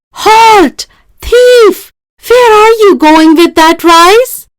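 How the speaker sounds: loud, high shouted challenge; guard crying out. A narrator's reading. Palace guard stopping Rani with the rice, shouting. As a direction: stop the thief at the gate. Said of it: "a guard cried".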